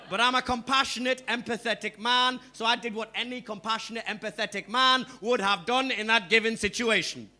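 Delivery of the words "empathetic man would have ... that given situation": ordinary talk, nothing marked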